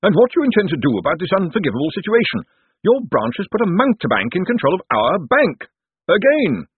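put-on high, scolding older-relative tirade; indignant. A narrator's reading. Josephine attacks Cosmo over the bank, indignant. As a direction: demand answers indignantly, climbing to the last word. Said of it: "She sprang up again for an encore".

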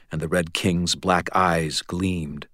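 usual speaking voice

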